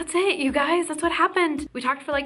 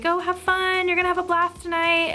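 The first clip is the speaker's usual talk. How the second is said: higher-pitched cheery imitation; sweet, excited; 10 semitones higher, 4 dB louder than usual. Singer sending fans off to the show, sweet and excited. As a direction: imitate a bubbly, encouraging send-off. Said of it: "And was so sweet".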